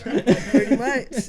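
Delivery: laughing through the words; giggling as she answers. Real, not acted. Laughing at Amanda joke.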